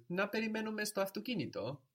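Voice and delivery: high-pitched να περιμένουμε, held up through αυτοκίνητο as a question; question intonation. Shown as a demonstration. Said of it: "As opposed to".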